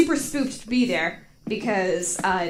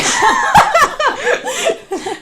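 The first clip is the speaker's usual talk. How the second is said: loud high laugh; 10 dB louder than usual. Real, not acted. Laughing at holy water joke.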